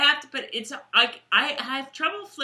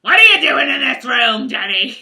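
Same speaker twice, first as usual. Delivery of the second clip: very loud British scolding; Sister Evangeline; 4 semitones higher, 12 dB louder than usual. Acted. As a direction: burst in scolding with a British accent. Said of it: "Sister Evangeline would walk in like".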